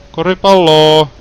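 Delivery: playful loud summons; calling out. Pretend. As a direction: call out for the lost ball like a pet. Calling for the basketball.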